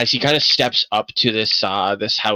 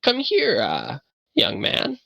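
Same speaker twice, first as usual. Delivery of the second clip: put-on higher, slow beckoning old man; old man calling; 12 semitones higher, 3 dB quieter, slower than usual. Old man beckoning Buckluck over to help, slow. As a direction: call the young man over gently. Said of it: "points over at Buckluck and says".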